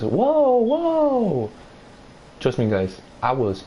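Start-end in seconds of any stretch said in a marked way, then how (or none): 0.0-1.5 high, drawn-out mock crowd whoa; crowd reaction. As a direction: imitate the hallway crowd gasping. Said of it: "all I hear around me is".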